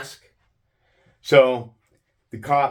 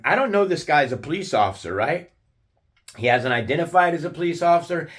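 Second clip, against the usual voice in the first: ordinary talk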